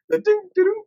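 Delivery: high-pitched ding-ding; imitated little chimes. Mimicking the little sounds that they play, high chimes. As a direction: sing out tiny register dings. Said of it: "the little sounds they play".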